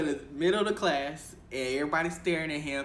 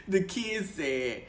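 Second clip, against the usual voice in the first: ordinary talk, nothing marked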